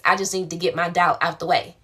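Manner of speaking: ordinary delivery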